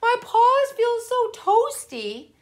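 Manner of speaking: high, slow contented sigh; cozy delight. A narrator's reading. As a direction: savor the comfort. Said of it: "thought Parker".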